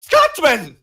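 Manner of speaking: loud, high greeting; excited shout. Real, not acted. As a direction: call out his name happily. Host greets the Scotsman loudly.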